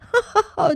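cloud's laugh. Acted.

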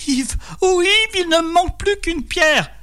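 extremely high-pitched wail; wife's frantic cries. A narrator's reading. Wife warning husband of the last stone, piercingly high cry. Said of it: "réveillé par les cris de Marie".

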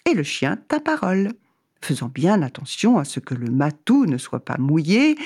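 plain reading voice

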